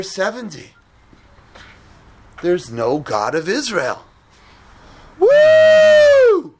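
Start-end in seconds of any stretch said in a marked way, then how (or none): 5.2-6.5 a huge, much higher whoop, much louder; wild party whoop. Acted. Persians cheering at the king's feast, loud whoop.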